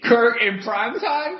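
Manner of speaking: high, slow, scoffing question; mocking disbelief. Real, not acted. Fan scoffing at Vikings fan, mocking disbelief. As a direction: drag it out in disbelief.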